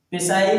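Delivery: पिसाई with the silent P wrongly sounded; mispronounced पिसाई, P audible; wrong pronunciation. Shown as a demonstration. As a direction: say पिसाई starting on a voiced-out P syllable. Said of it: "नहीं पढ़ेंगे बेटा".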